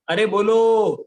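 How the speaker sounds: calls out loudly to the class; loud call to answer. Real, not acted. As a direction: raise the voice to make them answer. Teacher urging the class to answer. (कक्षा से ज़ोर से बोलने को कहना)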